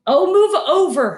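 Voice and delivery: high, impatient snarl. Acted. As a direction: snarl it, fed up. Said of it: "Hermione snarled".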